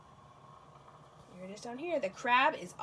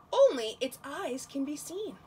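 normal talking voice

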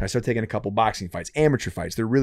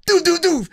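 high mock punch noises, doo doo doo; jab sound effects; 4 dB louder than usual. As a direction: imitate quick jabs with a squeaky doo. Kid's jabs imitated with noise.

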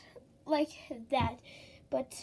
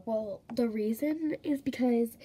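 ordinary delivery

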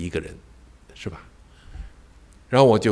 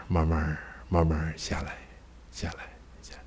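softer and softer until a whisper; fading to whisper; 4 semitones lower, 14 dB quieter than usual. Shown as a demonstration. Preacher showing his heart settling, fading to a whisper. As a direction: let the voice sink as the heart calms.

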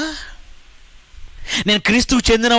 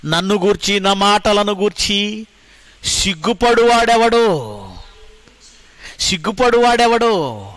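slow, measured, raised recitation; solemn verse reading; 3 semitones higher, slower than usual. Acted. Preacher reciting the verse. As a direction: intone the warning line by line.

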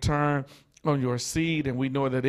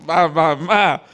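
laughing; 3 semitones higher, 8 dB louder, slower than usual. Real, not acted. Overjoyed after the sermon.

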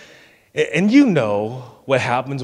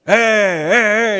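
mock monster roars; toddler playing monster; 5 semitones higher, 3 dB louder, much slower than usual. Dad imitating his son bouncing a Barbie, monster roar. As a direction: roar like a little boy smashing a doll around. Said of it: "like it's some sort of monster".

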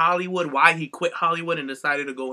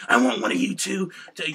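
Vince McMahon voice; 5 semitones higher than usual. Retold or imitated. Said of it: "does the Vince McMahon voice so perfectly".

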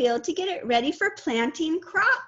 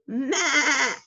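goat bleat; 3 dB louder than usual. Pretend.

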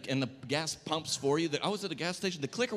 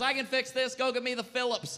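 higher, proud handyman dad; mock-confident father, higher; 8 semitones higher than usual. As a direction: play the dad sure of himself. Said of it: "My dad goes".

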